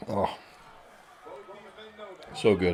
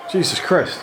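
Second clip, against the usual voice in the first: loud; 9 semitones higher, 6 dB louder than usual. Real, not acted. After choking.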